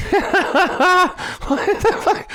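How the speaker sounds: very high, slow, laughing through it; laughing disbelief. Pretend. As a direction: crack up while asking.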